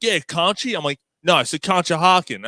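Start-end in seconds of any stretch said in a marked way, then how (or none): 0.0-0.7 lightly voiced Philly guy. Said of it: "He's like". 1.2-2.5 higher, louder put-on voice; prank-call voice. Prank caller correcting his friend, in a put-on voice. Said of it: "I had some voice on".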